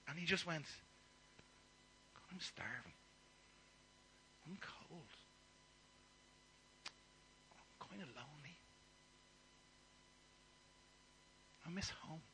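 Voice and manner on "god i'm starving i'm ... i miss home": soft, weary self-pity; quiet lament. Acted. Son alone with the pigs, quietly lamenting. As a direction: murmur it like a lost, hungry boy. Prodigal son's lament voiced.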